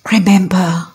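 hushed whispered Remember, pulled in close; whispered Remember before the point. Real, not acted. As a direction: breathe the word Remember as a secret. Preacher hushes before a key point.

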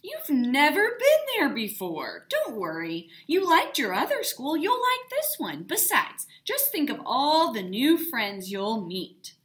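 a loud, bright, encouraging dad voice; reassuring dad. A narrator's reading. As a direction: cheer her up and talk her round. Dad reassures nervous daughter.

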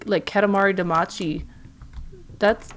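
normal talking voice

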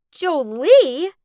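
loud, rising cry of disbelief; shocked shout. A narrator's reading. Spurned girl hearing another name in his proposal, shocked shout. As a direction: shout the name as a stunned question. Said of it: "I shouted".

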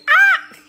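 very high loud laughing ah; startled laughing yelp. Real, not acted. Owner yelps and laughs as her parrot startles her. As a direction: yelp with a laugh as the bird grabs on.

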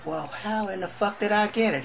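high-pitched, indignant disbelief; acting out a baffled patient, pitch jumping up; alarmed question.

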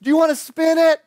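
put-on high, loud little-kid offer; eager kid. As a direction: ask like a kid sharing his favourite toy. Kid offers his spinner.

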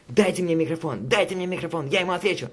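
high-pitched mock yell, no real volume. Streamer imitates rapper demanding the microphone, mock yell. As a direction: imitate a rapper yelling like a bum. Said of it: "орёт как бомж".

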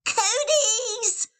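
high squeaky giggle; little kid teasing; tiny boy. Acted. Littlest boy, high laughing squeak. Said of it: "said the littlest boy".

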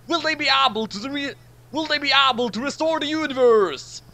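loud, high, hyped next-time promo; trailer announcer. Acted. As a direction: sell the cliffhanger like a TV trailer.

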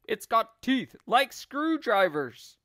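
high, loud scaremongering; mock alarm. Husband frightens wife about skillywigger's teeth, loud mock alarm. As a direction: pile on the horror loudly.

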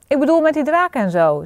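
hasty little clarification; hastily added. A narrator's reading. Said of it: "zei hij er snel achteraan".

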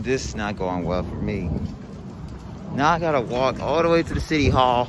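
loud, fed-up complaining; upset. Real, not acted. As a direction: sound thoroughly fed up. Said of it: "I'm in an upset mood".

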